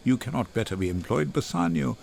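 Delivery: a hint of a doomed man, low and subdued; resigned Antonio. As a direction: speak low and resigned, as one marked for death.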